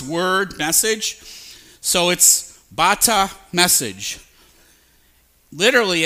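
normal talking voice

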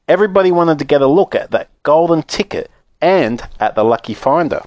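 usual speaking voice

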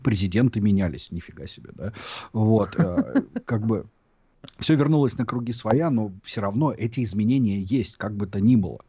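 ordinary delivery

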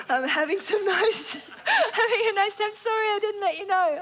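high voice breaking into laughter; laughing retelling. Retold or imitated. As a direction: read it out while trying not to laugh. Laughs while retelling text exchange.

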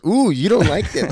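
high, loud teasing imitation; imitated gleeful teasing; mocking taunt. Retelling partner's taunt.

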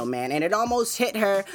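ordinary delivery

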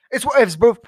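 loud laid-back bro delivery; dude voice. Shown as a demonstration. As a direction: toss it off like a chill bro.

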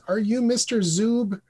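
put-on high, slow, careful little-girl question. Girl checking the writer's name, slow high little-girl voice. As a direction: ask slowly like a small child.

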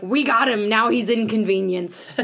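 loud triumphant police shout; triumphant cop. Pretend. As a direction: celebrate the arrest, overblown. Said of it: "And they're like".

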